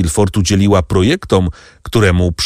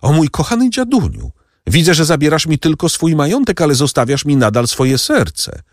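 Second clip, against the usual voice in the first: raised, fond cry to her grandfather; tender exclamation; 8 semitones higher than usual. A narrator's reading. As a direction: lift it high with love. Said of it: "że zawołała".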